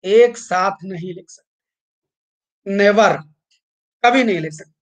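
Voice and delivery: slow and firm, hit never; emphatic never. Shown as a demonstration. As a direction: stress never and कभी नहीं. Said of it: "stressing never".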